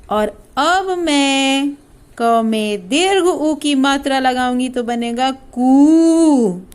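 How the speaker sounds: ordinary talk, nothing marked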